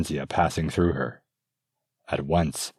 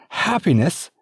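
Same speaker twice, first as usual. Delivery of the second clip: much higher, loud outraged echo; outraged repeat; 14 semitones higher, 6 dB louder than usual. Acted. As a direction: throw the word back at him harder. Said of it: "She was angry".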